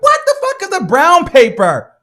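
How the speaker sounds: high, loud disbelieving outburst; baffled by the clerk's demand; incredulous shout. Retold or imitated. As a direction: blurt it out in disbelief. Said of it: "I'm like".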